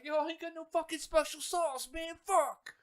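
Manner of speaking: high, loud mock complaint; mock outraged customer. Customer finds no sauce on his Big Mac, loud mock outrage. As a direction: yell it like you just bit into a sauceless burger. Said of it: "biting into a Big Mac and be like".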